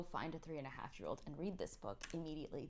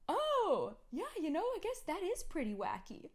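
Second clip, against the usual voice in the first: much higher, louder agreeing; mock enthusiasm; 11 semitones higher, 6 dB louder than usual. Mother retelling her reply, hammed-up agreement. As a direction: humor the child brightly.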